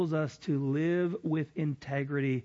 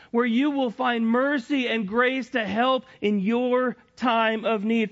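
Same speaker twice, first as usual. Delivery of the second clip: loud, high fervent preaching; 10 semitones higher, 8 dB louder than usual. Acted. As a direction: preach it with full fire. Preaching at full force.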